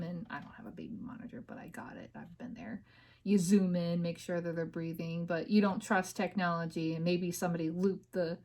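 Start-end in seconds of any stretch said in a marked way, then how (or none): 0.0-2.8 quick whisper tossed off to the side; whispered aside. Real, not acted. Speaker whispering an aside to listeners, quick and hushed. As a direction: hush it fast like a private admission.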